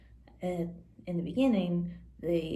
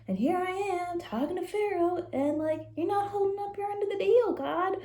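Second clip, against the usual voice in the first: higher, fast whiny complaint to God; exasperated Moses; 8 semitones higher, 3 dB louder than usual. Retold or imitated. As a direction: complain to God like a let-down friend. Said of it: "And he's like".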